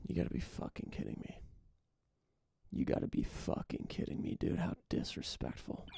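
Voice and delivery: whispered and fast, cursing under the breath; whispered disbelief. Real, not acted. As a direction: hiss it to yourself, stunned. Muttering to himself about a betrayal.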